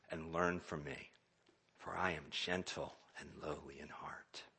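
tender invitation; gentle. Retold or imitated. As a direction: ease down to a gentle murmur. Gentle quote of Christ softens.

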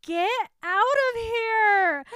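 high, very loud hammed-up yell; big theatrical shout imitating hostile kids; mock shout. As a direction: yell it out in exaggerated rejection. Kids reject her, shouted in retelling.

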